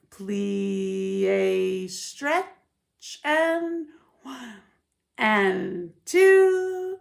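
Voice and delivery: sung counts with a clear two-beat pulse; counting duple meter. Shown as a demonstration. Teacher singing counts in duple meter to dancers. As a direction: mark it in a steady duple meter. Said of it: "I was counting duple meter".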